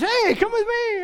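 high, eager calling out; eager invitation. Acted. Micah inviting the travelling Levite along, high eager call.

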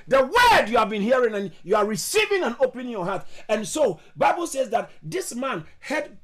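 impassioned pulpit proclamation; fervent preaching. Real, not acted. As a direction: preach it with mounting force. Preaching at full force.

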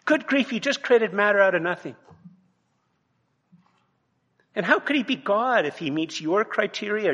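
high, loud, fervent sermon delivery; fiery pulpit voice; impassioned preaching. Acted. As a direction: preach it hard, rising with indignation. Preaching at full force.

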